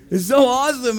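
ordinary delivery

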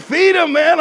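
loud, high retold urging; urgent exhortation. Jerry pressing the pastor to feed people, loudly excited. Jerry urges him again.

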